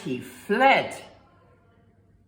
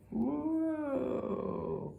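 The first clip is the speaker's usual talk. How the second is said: whoosh; 7 semitones higher, 7 dB quieter than usual. Acted. Farmer flees.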